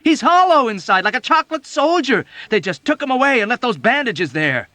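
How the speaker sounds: high loud shout. A narrator's reading. Dunbar shouting back at Yossarian.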